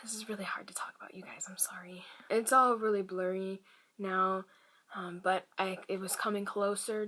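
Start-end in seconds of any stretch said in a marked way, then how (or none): 0.0-2.0 real hushed whisper, struggling to speak; barely voiced, hard to get out; whispering, upset. Real, not acted. Whispering to her viewers that this is hard to talk about. Upset while retelling the trauma.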